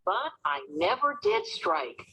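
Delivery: ordinary delivery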